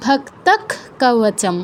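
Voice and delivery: ভক্তক কবচম very slowly, with a held final kh; visarga as kh. Shown as a demonstration. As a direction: stretch ভক্তক and stop it on kh. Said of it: "এরকম".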